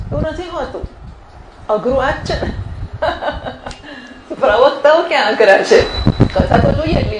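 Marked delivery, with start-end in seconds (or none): 2.6-4.0 quiet breathy chuckle; light amused laugh; soft laugh. Real, not acted. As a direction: laugh softly to yourself. Speaker laughs at own point.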